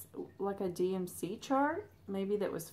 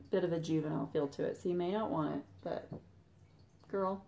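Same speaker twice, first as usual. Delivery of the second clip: normal talking voice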